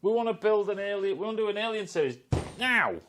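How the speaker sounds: high, far-off, quick exec voice; studio exec. Retold or imitated. Studio execs fishing for a director, high and quick. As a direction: pitch it like a rushed studio boss. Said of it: "they go fishing".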